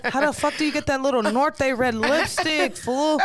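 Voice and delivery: broad, put-on Mexican-accented English; amused Mexican accent impression; mock Mexican accent. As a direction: play a jokey Mexican lover. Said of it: "A Mexican".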